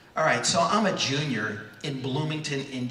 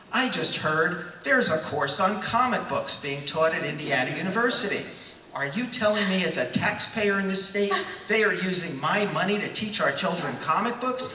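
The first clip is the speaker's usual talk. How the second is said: higher, incredulous indignant rant; mock outraged scream; 5 semitones higher than usual. Taxpayer ranting at a reporter about comics, mock outraged. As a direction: rail like a scandalized taxpayer, hammed up. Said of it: "I proceed to scream at him".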